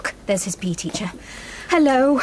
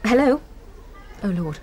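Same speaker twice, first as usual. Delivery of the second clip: ordinary talk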